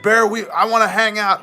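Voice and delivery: high, loud eager fan voice; hammed-up gushing fan. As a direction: mimic an overexcited admirer. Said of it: "they're like".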